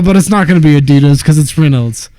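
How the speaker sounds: emphatic insistence. Retold or imitated. Excited skate talk quote.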